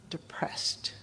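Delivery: billboard whispering its message; a low-volume, insinuating whisper; hushed whisper. Acted. Billboard whispers at her as she drives by, whispered.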